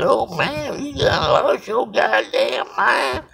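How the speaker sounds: high squeaky shout as the shocked customer; mimicking the customer. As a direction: squeal it back at him like he's freaked out. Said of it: "looked at me strange and said".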